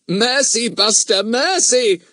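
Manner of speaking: high, loud cry for mercy; playful begging. Acted. Boy begs his excited dog for mercy, mock desperate. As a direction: beg loudly, high-pitched, under attack. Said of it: "begged Fatty".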